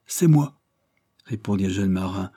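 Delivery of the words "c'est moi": insistent sailor. A narrator's reading. Wounded sailor claims the news.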